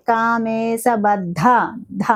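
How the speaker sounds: కామేశబద్ధ said very slowly with a heavy ధ; slow careful recitation. Shown as a demonstration. Teacher modelling the name for students, slow. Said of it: "చక్కగా".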